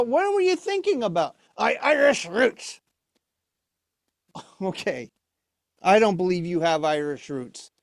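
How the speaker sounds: ordinary delivery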